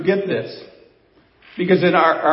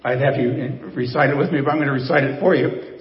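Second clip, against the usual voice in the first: ordinary talk, nothing marked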